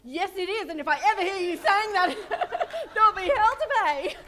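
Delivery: loud playful threat through laughter; mock threat. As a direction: bark a pretend warning, laughing. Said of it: "And you know what I said?".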